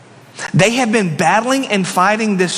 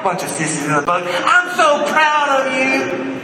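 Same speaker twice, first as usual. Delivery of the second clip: much higher, beaming praise; proud Jesus; 7 semitones higher than usual. Retold or imitated. Jesus praising Simon Peter, higher and beaming. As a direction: gush over Peter like a proud father. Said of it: "that's like".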